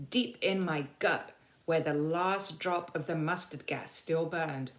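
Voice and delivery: ordinary delivery, nothing marked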